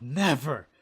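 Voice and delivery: a furious friend warns him off; stern warning. Acted. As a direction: lay down the law. Keisu scolds Anson after slap.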